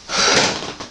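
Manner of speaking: real winded puff of air; voiceless exhale, short of breath; out-of-breath sigh. Real, not acted. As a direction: blow out a breath to recover. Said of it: "Why am I so unfit".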